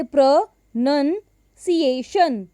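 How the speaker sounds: प्रोनंसिएशन in slow pieces, pro, nun, see-ay, shun; slow syllable-by-syllable प्रोनंसिएशन; broken into syllables. Shown as a demonstration. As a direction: split प्रोनंसिएशन with pauses, NUN in the middle. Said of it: "आप उस word को छोटे-छोटे".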